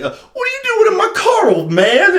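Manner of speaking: very high, fast, mock-outraged voice; irritated young bully. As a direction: squawk it at an old man. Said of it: "he's like".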